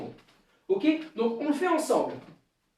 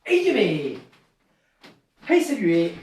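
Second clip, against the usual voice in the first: barks the counts loud like a sensei leading the class; shouted dojo commands; 3 dB louder, slower than usual. Shown as a demonstration. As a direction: give each count a sharp, loud call. Leads dojo drill with shouted counts. (commandes de dojo criées, comptes lancés fort comme un sensei)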